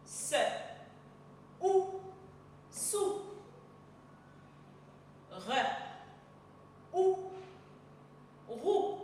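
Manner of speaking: letter sound, vowel, then the blend, each set apart; isolated phonics sounds with long pauses between; sounding out syllables. Shown as a demonstration. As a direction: chant each sound separately for the class. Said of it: "On lit ensemble les syllabes".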